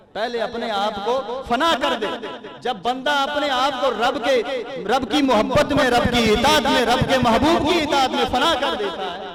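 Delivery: high-pitched, building sermon cadence; impassioned preaching. Real, not acted. Preacher exhorting listeners to lose themselves in devotion, impassioned. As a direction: build each repeated phrase with fervour.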